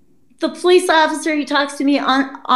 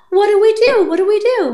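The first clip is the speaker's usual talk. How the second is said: panicked mom; 10 semitones higher, faster than usual. Retold or imitated. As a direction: plead like a mother in a panic. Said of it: "mom was all like".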